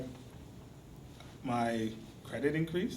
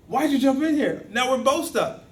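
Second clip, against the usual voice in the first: high, loud acted protest; exasperated outburst; 9 semitones higher, 8 dB louder than usual. Joe to the friend who jumped in, exasperated outburst. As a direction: demand an explanation, voice shooting up in dismay.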